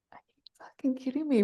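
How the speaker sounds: hushed, quick disbelief; incredulous whisper. Retold or imitated. Retelling her reaction to a pool of joy, incredulous whisper. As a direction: whisper it fast, as if astonished. Retold disbelief at sudden feeling.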